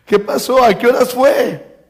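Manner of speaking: high-pitched, eager acted questions; urgent questioning. Father asking servants about son's recovery, acted excitement.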